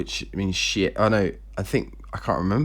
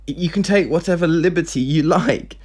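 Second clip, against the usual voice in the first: indignant challenge; 7 semitones higher than usual. Acted. Daughter challenges her evasive father.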